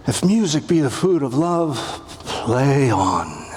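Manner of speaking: dreamy indulgent sigh on "play on"; languorous Orsino. Shown as a demonstration. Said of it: "Languorous, self-indulgent".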